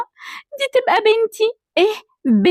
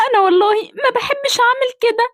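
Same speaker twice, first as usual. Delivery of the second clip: usual speaking voice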